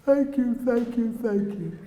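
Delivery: high thanks, sinking and slowing; imitating tearful gratitude. Frenchman to the teller after the explanation, imitated tearful thanks. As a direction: act out tearful thanks that start to ebb. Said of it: "you broke down crying".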